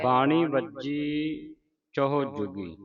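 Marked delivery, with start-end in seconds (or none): 0.0-1.5 ਬਾਣੀ said slowly with a clear ṇ, not ਬਾਜੀ; slow, careful ਬਾਣੀ ਵੱਜੀ; correct word. Shown as a demonstration. Teacher corrects the misread word, slow and deliberate. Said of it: "ਬਾਣੀ ਲਿਖਿਆ ਨਾ ਇੱਥੇ".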